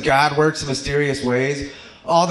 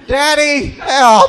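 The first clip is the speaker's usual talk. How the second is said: put-on high, loud child's cry for daddy; child calling for help; 11 semitones higher, 7 dB louder, much slower than usual. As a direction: shriek like a scared little kid. Child crying out in store.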